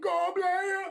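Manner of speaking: Goblin, Bajan style, bright and punchy; Bajan accent. Shown as a demonstration. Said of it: "you gotta say it with the Bajan accent".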